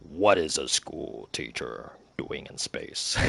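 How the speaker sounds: slow it down in a deep silly voice; trying a deep voice. Retold or imitated. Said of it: "trying to go through various voices".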